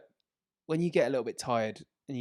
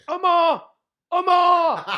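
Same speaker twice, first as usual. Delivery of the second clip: much higher, louder yell; frantic yelling; 14 dB louder, much slower than usual. Retold or imitated. Mother shouting for her missing son, frantic yell. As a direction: call for a lost child from the bus. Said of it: "She's yelling".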